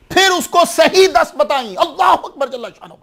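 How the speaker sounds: high, soaring preacher's exclamation; rising fervour. Real, not acted. Preacher to congregation, exclaiming in high fervour. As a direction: lift the crowd with awe at the feat. Preacher exults at Bukhari's feat.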